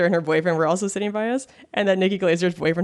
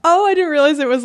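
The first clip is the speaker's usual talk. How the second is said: laughing surprise; 11 semitones higher, 6 dB louder than usual. Real, not acted. Laughing surprise at double fist bump.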